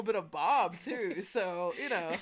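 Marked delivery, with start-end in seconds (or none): none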